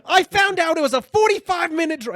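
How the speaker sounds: imitated high, loud comic outburst; outraged reaction. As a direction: yell it in hammed-up disbelief. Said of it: "this is his exact reaction".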